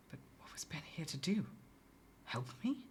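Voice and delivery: fast whispered worry; quick whisper. A narrator's reading. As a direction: rush it under your breath. Anxious inner question.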